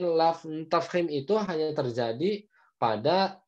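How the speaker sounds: ordinary talk, nothing marked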